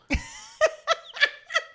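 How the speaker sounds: real squeal of laughter; high laughing squeal. Real, not acted. Comic recalling the heckler's husband, high burst of laughter. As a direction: crack up at the memory.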